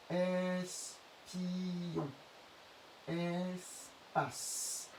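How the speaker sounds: "Espion" and "Espace" slowly, with a long held s; syllable demo. Shown as a demonstration. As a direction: stretch the first syllable "ès" in each word. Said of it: "la première syllabe, on dira S".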